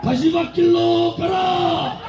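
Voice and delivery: slow roared battle cry; roaring rallying speech. Real, not acted. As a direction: chant each line like a war cry. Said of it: "큰 목소리로 구호를 해주십시오".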